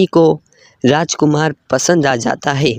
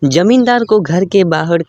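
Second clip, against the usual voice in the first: usual speaking voice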